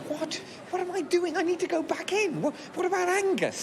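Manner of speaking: high-pitched frantic yelp; panic, voice shooting up high; frantic outburst fleeing the bank; panicked. Real, not acted. Panicked turn back to the bank.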